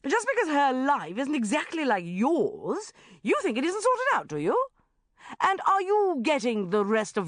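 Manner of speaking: loud, high, scathing attack; indignant tirade. A narrator's reading. Sharon turning on the smug married banker, indignant. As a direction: tear into him, turning the question on him.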